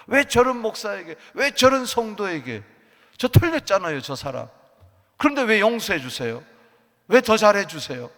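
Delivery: loud, indignant shouting at God; jealous protest. Acted. A jealous believer loudly protests God's blessing of others.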